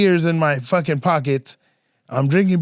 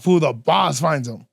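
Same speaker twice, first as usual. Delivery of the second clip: ordinary talk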